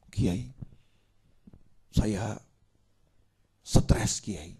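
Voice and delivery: soft, meek plea to the cleric; meek complaint. Acted. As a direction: sigh it out like a worn-down man. Jamaah stres mengadu ke kiai. (mengeluh lirih dan memelas)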